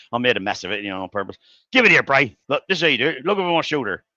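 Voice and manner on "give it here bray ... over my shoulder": louder, higher impatient tutor; bossy tutor. Retold or imitated. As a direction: bark it like the know-all tutor. Tutor grabs the welding gun.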